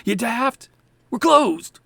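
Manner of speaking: exasperated high, loud yell; irate shout, falling hard at the end; shouting shopkeeper. Acted. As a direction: yell at a persistent caller through the door. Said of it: "shouts, you hear a voice".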